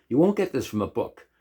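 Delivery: normal talking voice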